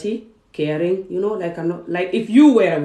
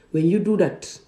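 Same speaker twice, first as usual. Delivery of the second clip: ordinary talk, nothing marked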